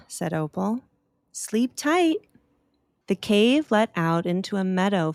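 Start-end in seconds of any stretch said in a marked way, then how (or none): 1.3-2.2 higher, gentle goodnight; high little-girl voice. Acted. Opal saying goodnight to the sleepy bat. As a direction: tuck the bat in with it.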